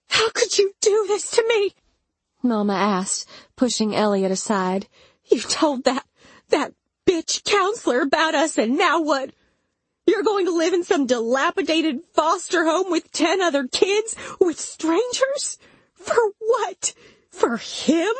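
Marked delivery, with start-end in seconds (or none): 0.1-1.7 high-pitched, strained outrage; shrill reproach. A narrator's reading. Mama blaming narrator after Elliot pulls back, shrill reproach. 5.2-18.1 high, strained outraged rant; angry tirade. A narrator's reading. Mama berating narrator for telling the counselor, angry tirade.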